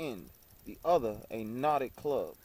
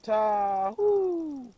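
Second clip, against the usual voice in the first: high, loud shepherd's call to the flock; 10 semitones higher, 6 dB louder than usual. A narrator's reading. Shepherd calling his flock, high loud cry. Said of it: "laid cry".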